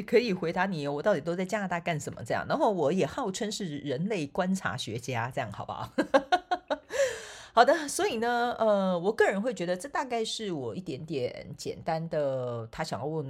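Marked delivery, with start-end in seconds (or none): 6.0-7.5 high falling laugh. Real, not acted. Laughing after calling herself a people watcher. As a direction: chuckle at your own joke.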